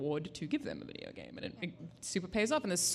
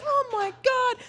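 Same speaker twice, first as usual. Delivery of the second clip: high, slow stunned awe; stunned gasp; 6 dB louder, much slower than usual. Retold or imitated. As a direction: let the twist floor you. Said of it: "And you're like".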